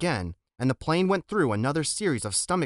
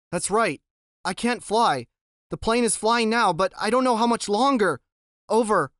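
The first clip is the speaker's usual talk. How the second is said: urgent anxious; 8 semitones higher, 4 dB louder than usual. Acted. Boy reporting to radio.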